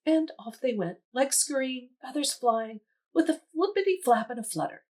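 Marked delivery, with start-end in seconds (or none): none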